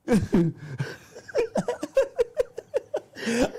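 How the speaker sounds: long high laugh. Real, not acted. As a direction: let a long laugh roll out. Laughing after admitting he was mad.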